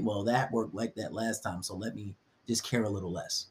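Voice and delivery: calm reasoning. Retold or imitated. Voicing a guy's calm inner reasoning.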